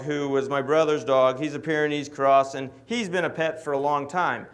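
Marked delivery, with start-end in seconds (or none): none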